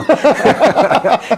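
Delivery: high, loud burst of laughter; cackling laugh. Real, not acted. Host cracks up at his own vampire voice, loud laugh. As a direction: laugh hard at yourself.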